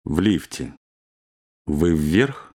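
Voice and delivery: usual speaking voice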